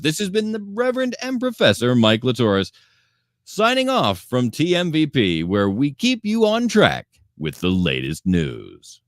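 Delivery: hammed-up newscaster sign-off; news anchor sign-off.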